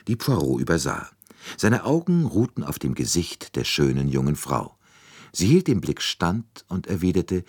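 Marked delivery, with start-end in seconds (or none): none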